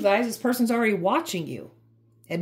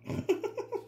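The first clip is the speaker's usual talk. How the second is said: soft breathy giggle; 13 semitones higher, 10 dB quieter than usual. Real, not acted. Laughs at her own cussing.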